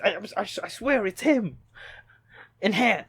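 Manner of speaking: hammed-up eureka over a blurry photo; mock TV investigator. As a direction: play an obsessed photo analyst.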